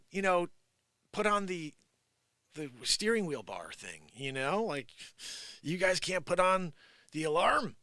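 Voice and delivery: ordinary talk, nothing marked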